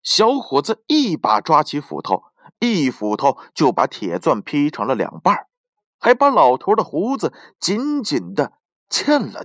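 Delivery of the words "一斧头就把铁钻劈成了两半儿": usual speaking voice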